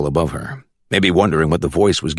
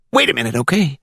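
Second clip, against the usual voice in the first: put-on country accent from the Glades; 8 semitones higher than usual. A narrator's reading. Caller on speakerphone checking for the judge, high country accent. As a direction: ask it in a high rural Florida accent. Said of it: "With that country accent".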